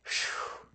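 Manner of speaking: soft, voiceless sigh; whispered blown-out whew; exhaled whew. Real, not acted. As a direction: let out a long hushed breath. Breathes out after the heavy point.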